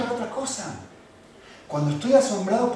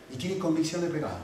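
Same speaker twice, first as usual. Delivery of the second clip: usual speaking voice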